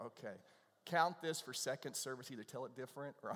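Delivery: ordinary talk, nothing marked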